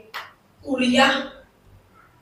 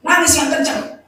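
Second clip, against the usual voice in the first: higher, insistent repeat; insistent urging; 6 semitones higher than usual. Retold or imitated. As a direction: urge them on, pitched up. (desakan dengan nada lebih tinggi)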